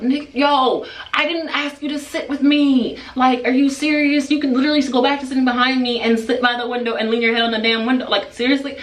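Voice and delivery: high-pitched, indignant rant to oneself; exasperated outburst. Retold or imitated. Inner outburst at seatmate's request.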